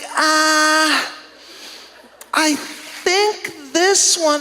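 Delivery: lightly voiced unsure store clerk dragging it out; a hint of a lost clerk stalling; clueless clerk. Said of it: "She's like".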